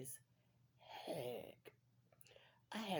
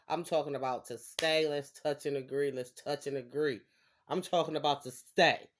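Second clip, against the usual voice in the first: loud emphatic declaration; 8 dB louder than usual. Real, not acted.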